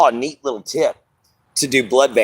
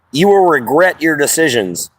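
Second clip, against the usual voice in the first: ordinary talk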